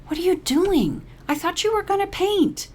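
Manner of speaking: very high surprised friend; Caroline's voice. A narrator's reading. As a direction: call after her, puzzled.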